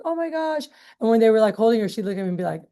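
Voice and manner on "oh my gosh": higher, imitating parents' alarm; lightly voiced worried parent; mock alarmed parents. Parents rush to crying daughter, mock alarm, higher.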